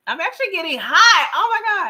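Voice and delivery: getting worked up with joy; excited. Real, not acted. Host overwhelmed by a fan in chat, flustered excitement. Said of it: "I'm so excited".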